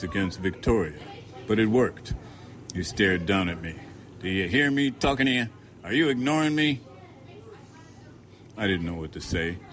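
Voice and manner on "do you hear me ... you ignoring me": demanding bigot. A narrator's reading. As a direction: lean in and demand an answer. Narrator voices the man demanding.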